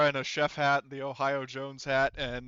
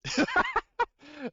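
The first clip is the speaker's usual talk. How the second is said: high, loud laugh; burst of laughter; 5 dB louder than usual. Real, not acted. Game master hearing the secret chef sauce line, bursting out laughing. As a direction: crack up briefly at a joke.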